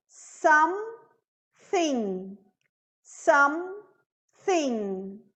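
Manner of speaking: "Something" split slowly as SUM, pause, THING; silent E. Shown as a demonstration. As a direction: hold the M of "Something", no vowel after it. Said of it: "Esse E não pronuncia". ("Something" sem pronunciar o E)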